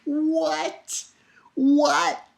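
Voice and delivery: high, stunned double take; shocked disbelief. Retold or imitated. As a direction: gasp it out in disbelief. Shocked by the fire dragon.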